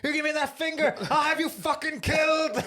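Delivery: high, loud mock tirade; acted shouted threat. Film fan playing the producer threatening a driver, shouting.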